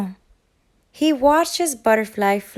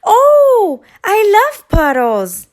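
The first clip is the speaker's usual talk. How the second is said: very high, loud yell with a laugh; loud gleeful shout; excited yell; 12 semitones higher, 9 dB louder, slower than usual. Acted. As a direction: squeal with delight at the puddles. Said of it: "Thistle yelled".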